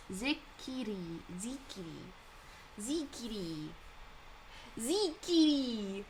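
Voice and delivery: Zikiri, each syllable tried slowly; sounding out Zikiri carefully; trying out the name. Shown as a demonstration. Streamer sounding out a viewer's name Zikiri, slowly.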